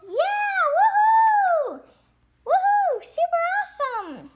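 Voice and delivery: high, loud cheering for the pup; excited cheering. Pretend. Judge cheering a puppy's slide, loud and high. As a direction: cheer like the crowd went wild.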